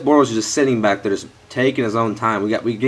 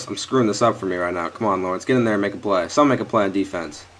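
ordinary talk

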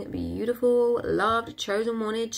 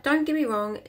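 ordinary talk, nothing marked

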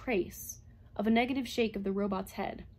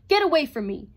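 loud, sudden furious order; shriek; 2 semitones higher, 9 dB louder, faster than usual. A narrator's reading. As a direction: shriek it at the robot. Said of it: "shrieked at the robot".

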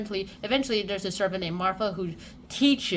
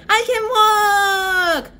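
triumphant shout; 12 semitones higher, 12 dB louder than usual. Acted. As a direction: shout it in amazed joy, stretching the last word. Colin discovers he can walk.

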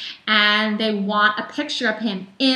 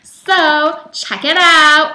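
loud slow pitch; 5 semitones higher, 8 dB louder, slower than usual. Acted. Urging viewers to Instagram.

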